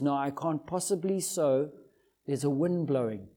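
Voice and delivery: low, soft second thoughts; lightly voiced farmer talking himself out of it; quietly resigned, half to himself; hesitant farmer. Man stops himself at the door.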